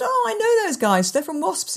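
high-pitched, imitated friend's excitement; retold eager recognition, voice way up; excited recognition. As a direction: pipe up on spotting people you know. Friend spots players she knows.

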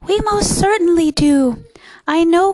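high, loud little voice; high-pitched, emphatic small voice; piping voice. A narrator's reading. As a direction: pipe up loudly as a tiny townsman. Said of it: "piped the voice".

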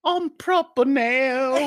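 high, slow fancy British; hammed-up refined British voice; mock posh British accent. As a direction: draw it out as a would-be posh Brit. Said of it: "I'll make it better".

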